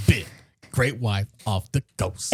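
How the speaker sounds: low, slow parody of suspense; mock dramatic echo. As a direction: echo it back with hammed-up gravity. Mocking echo of her hushed delivery.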